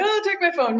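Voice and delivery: put-on high kid's voice handing over the phone; a frightened child giving up his phone; scared kid. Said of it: "and he was like".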